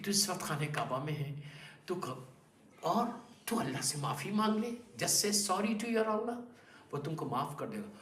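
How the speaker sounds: gentle, soft reassurance to a sinner; softly, with love. Retold or imitated. Ali gently urging the sinner at the Kaaba, soft and tender. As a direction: soften the voice, coax him kindly. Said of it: "بڑی softly, بڑی محبت کے ساتھ".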